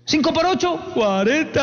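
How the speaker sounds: put-on high, small child's voice answering in fear; frightened child. Niño asustado respondiendo al maestro, high timid child voice. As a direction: squeak it out like a scared schoolboy.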